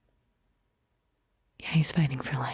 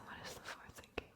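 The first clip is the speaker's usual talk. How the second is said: quick, barely audible whisper; very soft, hurried whisper; whispered echo; 22 dB quieter, faster than usual. Acted. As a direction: whisper it fast like a faint echo. Question echoed as a faint whisper.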